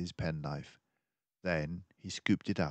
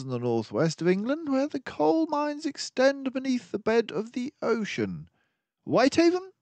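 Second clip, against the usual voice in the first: higher, brisk detective voice, urgent; a sharp-minded sleuth laying out the danger; keen detective warning of the villains; mouse detective; 5 dB louder than usual. Acted. Detective reasoning aloud.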